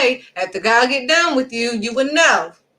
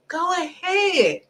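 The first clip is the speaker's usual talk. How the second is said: higher, slow, softer taunting go ahead; mocking go ahead; 6 semitones higher, 4 dB quieter, much slower than usual. Preacher voicing God taunting the disobedient people, slow and high. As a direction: drag it out like a dare. God's voice, drawn-out second take.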